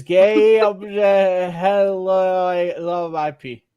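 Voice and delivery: slow, halting reading of nonsense vowels; laboured, slurry attempt at reading gibberish; garbled sounding-out. Real, not acted. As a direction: stumble through it, dragging out each vowel. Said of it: "sound like you're having a stroke".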